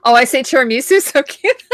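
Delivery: high, giggly, laughing through the words; amused laughter. Real, not acted. Host amused by how she says tiramisu, laughing. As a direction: break up laughing while talking.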